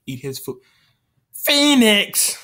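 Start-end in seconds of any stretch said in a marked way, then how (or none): 1.3-2.4 shouted name, much higher and louder; calls out the teammate's name in a high yell; calling out. Real, not acted. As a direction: yell the name across the room. Calling out to a player.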